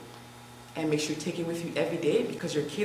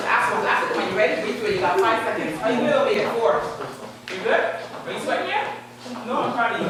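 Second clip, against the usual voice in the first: loud, pumped-up fitness coach; workout coach; 5 semitones higher, 4 dB louder than usual. Acted. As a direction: count them through the reps. Leads a live workout count.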